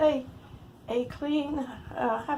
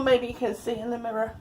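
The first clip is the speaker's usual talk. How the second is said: ordinary talk, nothing marked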